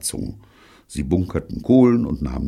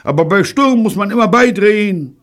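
high, insistent yelling; bellowing; 8 dB louder than usual. A narrator's reading. Bunny insisting to Betty in the storm, bellowing. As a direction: shout it flat out over the gale. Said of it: "brüllte Bunny".

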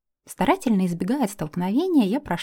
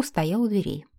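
ordinary delivery

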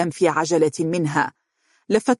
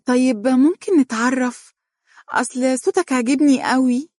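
coy, higher teasing flirt; flirtatious; 8 semitones higher than usual. A narrator's reading. Wife flirting with husband on the phone, coy and higher. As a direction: play the coquette, higher and sweet. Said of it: "قالت عبير بدلال".

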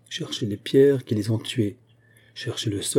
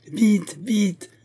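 high, pressing cry of hurry; urgent call; 11 semitones higher than usual. A narrator's reading. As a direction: call him on, pitched high. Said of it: "répéta Aramis".

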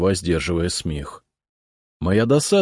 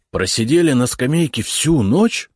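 surprised; 7 semitones higher, 5 dB louder than usual. A narrator's reading. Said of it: "изумился я".

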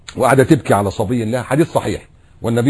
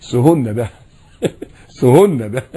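laughing. Real, not acted. Mocking the grumbler.

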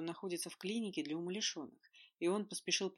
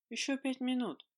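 drowsy, higher, half-awake plea; sleepy mumble; 8 semitones higher, 4 dB louder than usual. A narrator's reading. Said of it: "сонно пробормотала Ольга".

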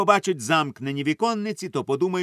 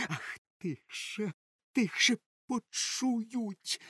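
hushed, slow, frightened plea; very soft, drawn-out anxious whisper; pleading; 9 semitones higher, 7 dB quieter, much slower than usual. A narrator's reading. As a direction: beg them to keep it down. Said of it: "благали її очі і болісний вираз".

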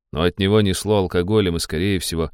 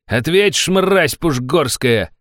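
high furious yelling; furious shout; 8 semitones higher, 5 dB louder, slower than usual. A narrator's reading. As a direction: scream at him. Baron keeps yelling.

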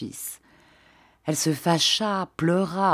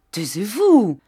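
stern command; 4 semitones higher, 6 dB louder than usual. A narrator's reading. Baron tries to stop Julien.

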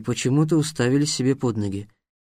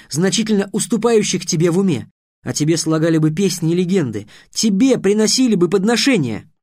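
louder, insistent persuading; pressing hard; 6 semitones higher, 4 dB louder than usual. A narrator's reading. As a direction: push your point harder. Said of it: "продолжал наседать Трикс".

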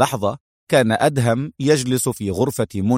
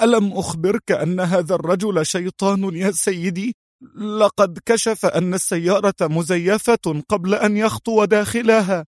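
high-pitched subordinate reporting to his boss; alarmed underling; 8 semitones higher than usual. Acted. Subordinate telling his boss the car trick failed, high voice.

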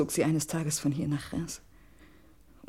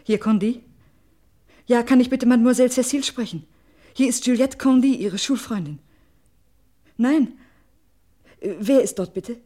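urgent loud phone voice; 7 semitones higher, 10 dB louder than usual. Acted. Frantic phone call to Cécile.

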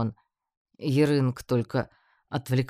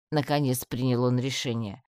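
ordinary delivery, nothing marked

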